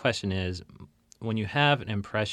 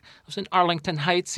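lightly voiced local character, pitched up; mimicked suburban comic; 8 semitones higher than usual. Host imitates a comic from suburban Chicago, mimicked higher voice. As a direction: put on the guy's voice, higher.

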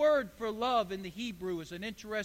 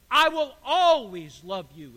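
louder, heartfelt declaration; emphatic; 3 semitones lower, 9 dB louder than usual. Acted. God declaring lasting love to his people, emphatic and louder. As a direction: proclaim it like a promise. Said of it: "is what he's saying".

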